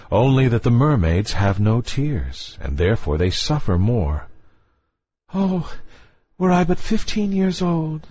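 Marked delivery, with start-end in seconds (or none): 5.3-8.1 put-on higher, longing young girl voice; wistful little mermaid yearning upward. A narrator's reading. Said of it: "said she".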